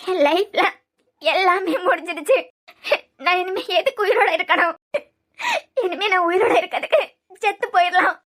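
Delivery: weeping, hopeless lament; sobbing despair. Acted. Daughter saying she cannot go on living, sobbing despair. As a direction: catch the breath in sobs between phrases. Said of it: "இப்படி அழுதுகிட்டு இருக்கச்சுல".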